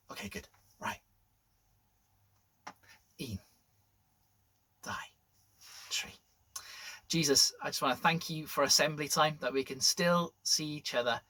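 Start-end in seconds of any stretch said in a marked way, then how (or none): none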